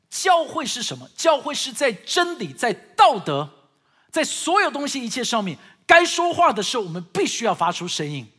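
loud, impassioned pulpit delivery; fervent preaching. Acted. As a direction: preach it out with full force. Preaching at full force. (激昂讲道)

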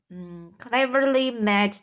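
ordinary delivery

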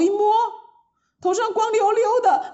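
high shocked "ah"; startled cry. A narrator's reading. Maids find heads bald.

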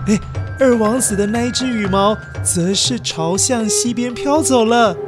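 usual speaking voice

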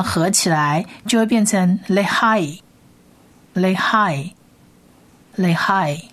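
Lehai said slowly, le-HAI, repeated; slow model pronunciation. Shown as a demonstration. Teacher models Lehai for listeners, slow careful pronunciation. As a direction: sound out Lehai slowly for learners.

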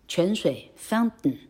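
ordinary talk, nothing marked

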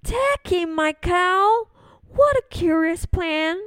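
she can't believe the plan; puzzled old lady. Acted. Old lady puzzled by the wise man's advice. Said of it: "said the wise old man".

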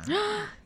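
quick breathy gasp of awe; sharp gasp. Real, not acted. Co-host gasps at the luxury gown. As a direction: gasp as if the price takes your breath.

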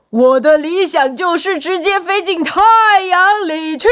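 high, loud, eager little pea; excited proclamation. A narrator's reading. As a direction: proclaim your dream at full voice. Second pea declares its dream. (激动地高声宣告理想)

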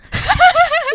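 high, loud burst of laughter; loud shrieking laugh at the prank. Real, not acted. Prank reveal.

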